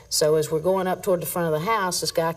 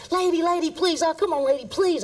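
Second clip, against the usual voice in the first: higher, whiny begging voice; pleading suspect; 11 semitones higher than usual. Retold or imitated. Officer retelling the pinned suspect's begging, higher and whiny. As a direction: beg like the man pinned under your boot. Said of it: "this guy's down here going".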